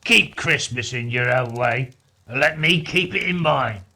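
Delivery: loud, stern and dismissive. A narrator's reading. Scrooge telling his nephew to leave him be, stern. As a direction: lay down the rule sternly. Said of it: "returned the uncle sternly".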